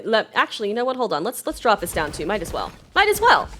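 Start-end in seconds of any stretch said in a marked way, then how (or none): 3.0-3.5 petty. Real, not acted. Petty gaming taunt.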